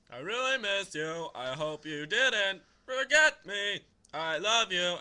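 loud, slow, drawn-out recital; hammed-up sing-song love letter; funny letter reading. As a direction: stretch the gushing words right out. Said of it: "I read them a funny way".

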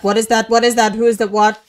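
panicking at something on screen; alarmed shouting. Real, not acted. Player startled by a monster, yelling in alarm. Creature appears, repeated alarmed questions.

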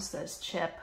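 usual speaking voice

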